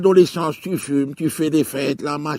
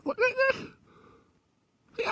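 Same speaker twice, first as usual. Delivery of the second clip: voice cracking high; 10 dB quieter, faster than usual. Retold or imitated. Mother's voice giving out.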